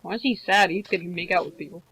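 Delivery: ordinary delivery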